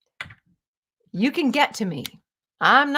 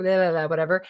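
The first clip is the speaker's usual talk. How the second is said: usual speaking voice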